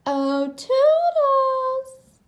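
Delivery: very high, slow drawn-out call; long goofy sing-song call. A narrator's reading. Goofy calls his helper Toodles, long high cry.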